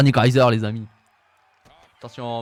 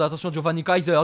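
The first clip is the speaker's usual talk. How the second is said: normal talking voice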